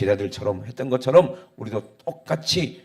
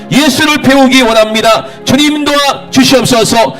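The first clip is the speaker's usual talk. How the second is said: building from earnest to loud, higher crying out; fervent prayer; 11 semitones higher, 13 dB louder than usual. Acted. Pastor crying out to God for the congregation, rising fervent prayer. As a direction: pour it out, growing in force toward God.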